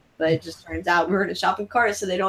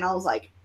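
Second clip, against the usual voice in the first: awed gasp; 2 semitones lower, 6 dB quieter than usual. Retold or imitated. Gasp at [name]'s piano.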